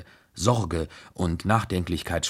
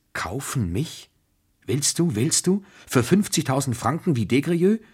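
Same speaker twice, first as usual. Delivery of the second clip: high, distraught questions climbing in pitch; acted near-hysterical challenge, without full sobs; sobbing outburst; 6 semitones higher than usual. A narrator's reading. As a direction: throw each question higher, on the verge of tears. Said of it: "stieß sie unter krampfhaftem Schluchzen hervor".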